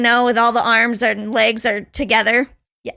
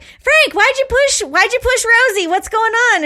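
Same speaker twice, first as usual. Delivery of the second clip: high, loud mock-mom voice; pitched-up nagging question; hammed-up mom, far above own voice; 10 semitones higher, 3 dB louder than usual.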